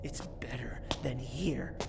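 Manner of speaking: whispered, soft reply; a hushed, resentful whisper; bitter whisper. Acted. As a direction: mutter it under your breath. Son answers bitterly under breath.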